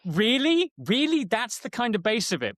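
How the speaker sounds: high, disbelieving double "Really?"; incredulous. Retold or imitated. Mock disbelief while explaining emotional availability to the hosts. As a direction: ask it as if you can't believe it's that simple.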